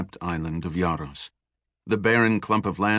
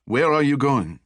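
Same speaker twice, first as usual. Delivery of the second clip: put-on French accent; 6 semitones higher, 4 dB louder than usual. A narrator's reading. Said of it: "with a distinct French accent".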